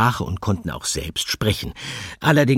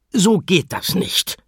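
high, slow stern scolding; scolding teacher; 12 semitones higher, 4 dB louder, slower than usual. Acted. Teacher scolding the animals in the kitchen, high and stern. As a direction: tell them off like a fed-up teacher. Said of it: "schimpfte sie".

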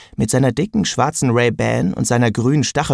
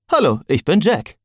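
sword Jack's voice; 5 semitones higher than usual. A narrator's reading. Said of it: "sagte Jack".

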